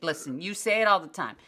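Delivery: normal talking voice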